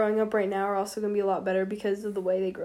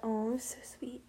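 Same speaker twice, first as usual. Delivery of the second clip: whispering; 14 dB quieter than usual. Real, not acted. Gushing about sister's note.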